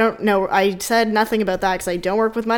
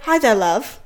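fake British accent; slower than usual. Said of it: "She was like".

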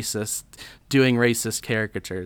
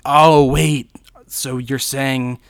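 Jack Nicholson; 6 dB louder than usual. Acted. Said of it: "And Jack's like".

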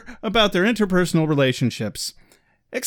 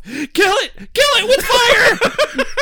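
very high, loud, laughing shriek; mock shriek; 5 dB louder, slower than usual. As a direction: scream it in hammed-up horror. Son shrieking at the hug.

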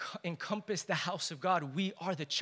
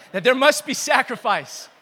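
louder, laughing through the line; laughing repeat; 4 semitones higher, 12 dB louder than usual. Real, not acted. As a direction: shake off the stumble with a laugh and hit it again. Repeats after almost falling, laughing.

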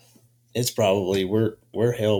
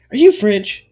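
higher and louder, a playful imitation of the hostess; mock curious hostess; 10 semitones higher, 8 dB louder than usual. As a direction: lift the voice into a curious, teasing question. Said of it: "she's like".

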